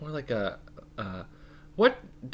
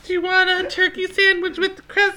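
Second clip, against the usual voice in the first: much higher, louder, laughing sing-song; doting mom voice; 11 dB louder than usual. Retold or imitated. Voicing mom pampering her adult son. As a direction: fuss over a child sweetly.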